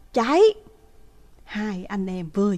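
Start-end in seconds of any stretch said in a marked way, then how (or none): 0.1-0.4 sharp panicked shout. Retold or imitated. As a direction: yell at him to run. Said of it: "hét thật to lên". (hét to hoảng hốt)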